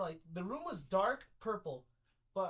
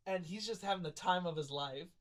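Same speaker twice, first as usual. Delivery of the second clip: ordinary talk, nothing marked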